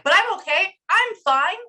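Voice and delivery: high, loud insistence on being fine; overwrought insisting. Retold or imitated. Medium acting out how the client feels, overwrought insisting. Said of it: "That's how they feel".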